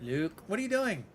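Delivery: a hint of a needy grandpa; old grandpa voice. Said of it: "I'd be like".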